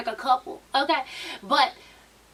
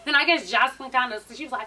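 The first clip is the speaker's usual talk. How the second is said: ordinary delivery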